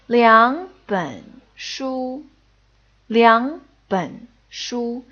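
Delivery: 两本书 with liáng rising, běn and shū slowly; drawn-out 两本书, liáng lifted; slow tone demo. Shown as a demonstration. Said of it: "changes to second tone".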